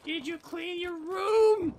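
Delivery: high, drawn-out mother impression; mock nagging mom. Gamer playing friend's mom, high slow mock nagging. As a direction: nag in a slow, high put-on voice. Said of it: "that's what Boru's mom is gonna be like".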